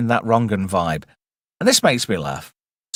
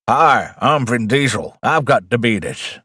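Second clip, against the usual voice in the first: slow mock movie-star impression; comic, slow celebrity voice; Vin Diesel; slower than usual. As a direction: drag the words out in a jokey impersonation. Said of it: "looks very much like Vin Diesel".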